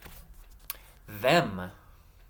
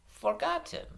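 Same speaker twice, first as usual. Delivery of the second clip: FORGOTTEN run together as one smooth word, higher; blended smoothly; 14 semitones higher than usual. Shown as a demonstration. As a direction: say "Forgotten" joined up, no gaps. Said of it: "blend them ... a little bit smoother".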